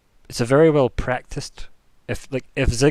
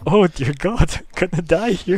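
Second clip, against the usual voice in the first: high, loud mock panic; hammed-up despair; 7 semitones higher, 6 dB louder than usual. Game master as Edgar dreading death with rat child, mock despair. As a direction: wail it in comic despair, breaking into laughter. Acted dread of dying in corridors.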